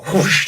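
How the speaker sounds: loud, airy whoosh; punchy mouth-made swish; imitated whoosh. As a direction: rush of air for a swift kick. Imitates a kick's movement.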